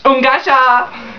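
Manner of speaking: high, slow joyful squeal; high drawn-out delighted exclamation to a best friend; excited squeal. Retold or imitated. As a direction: let it out as a high happy cry.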